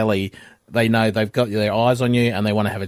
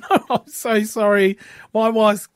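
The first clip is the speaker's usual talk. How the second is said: apologetic laughing; 8 semitones higher than usual. Retold or imitated. The lady apologises to Trev for her laughing friend. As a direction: excuse your friend through laughter. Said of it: "And she goes".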